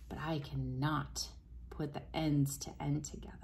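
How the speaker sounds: soft, whispered and slowing. Real, not acted. Straining the magnets together in front of the child, whispered. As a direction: hush it while pushing the magnets.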